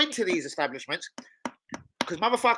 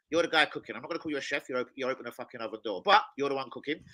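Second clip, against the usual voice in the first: ordinary delivery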